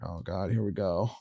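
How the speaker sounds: mild muttered oh God; muttered dread. Retold or imitated. Boyfriend dreading girlfriend's jealousy, muttered under breath. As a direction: sigh it out under your breath. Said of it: "I'm just like".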